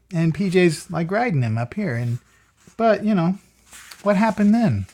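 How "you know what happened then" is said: ordinary delivery, nothing marked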